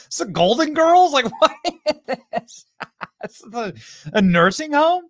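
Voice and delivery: laughing disbelief. Real, not acted. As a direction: poke fun through laughter. Mocks the studio audience.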